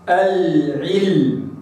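"العلم" very slow, sakin lam held its full length; slow recitation of al-ʿilm. Shown as a demonstration. Teacher reciting "العلم" with the lam held long. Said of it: "هكذا".

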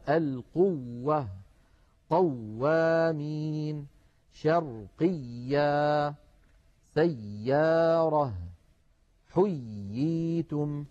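very slow, each doubled waw and ya pressed; clear shadda. Shown as a demonstration. Teacher reading the board examples in slow recitation. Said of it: "لا بد من بيان التشديد".

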